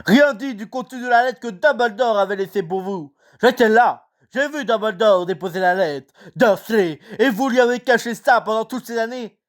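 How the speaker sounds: a touch of quivering rage, high; trembling with rage. Acted. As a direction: spit each syllable in anger. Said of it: "d'une voix tremblante de rage".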